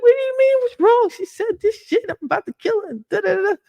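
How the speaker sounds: very high-pitched, hammed-up outrage; mock shrieky ranting at mom's calm question; wild and pissed. Daughter venting to her calm mother, mock furious. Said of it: "I'm wild and I'm pissed".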